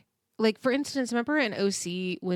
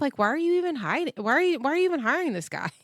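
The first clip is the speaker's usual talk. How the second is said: higher, fast, exasperated disbelief; exasperated rant; 7 semitones higher, faster than usual. Real, not acted. Fed up with the star ignoring her staff, higher fast exasperation. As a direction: rattle off the questions in frustration. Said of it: "it's so frustrating".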